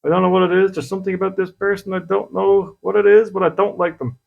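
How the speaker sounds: mock inner voice, mildly uneasy and repelled; uneasy distaste. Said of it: "And you go".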